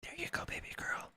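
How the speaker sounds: high, airy whispered pet name; breathy whisper. Pretend. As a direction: whisper it sweetly to a donor. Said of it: "Call me baby girl while you kiss my forehead".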